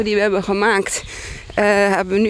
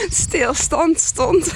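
giggly, slow and high; laughing while repeating; 9 semitones higher, much slower than usual. Real, not acted. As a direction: laugh through the words.

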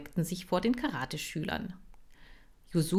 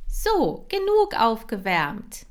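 loud, high, slow trainer call; called command; 5 semitones higher, 6 dB louder, slower than usual. Acted. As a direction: call the exercise to the class. Kira kommandiert. (laut und hoch gerufenes Kommando)

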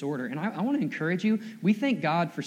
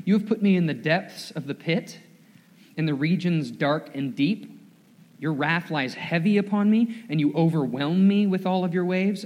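rising, louder solemn psalm reading; scripture reading; 4 dB louder than usual. Acted. Pastor reading the psalm aloud to his church, solemn. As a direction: read it like a lament building.

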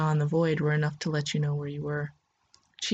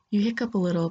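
plain reading voice

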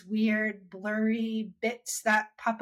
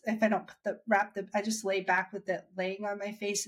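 normal talking voice

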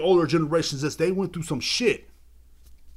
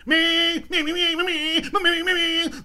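high revving "man man man" like an engine, much higher; dirt bike imitation; 12 semitones higher, 3 dB louder, slower than usual. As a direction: rev it up like a dirt bike through the words. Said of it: "You sound like a fucking dirt bike".

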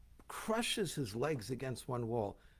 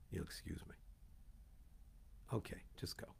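softer, breathless whispered aside; 7 semitones lower, 14 dB quieter, faster than usual. Acted. Angel muttering aside to Bilam, whispered. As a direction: drop to a hush, as if under your breath. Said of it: "in brackets".

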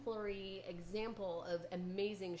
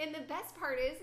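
ordinary talk

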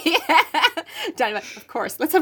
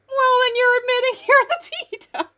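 mock retort laughing; 13 semitones higher than usual. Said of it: "be like".

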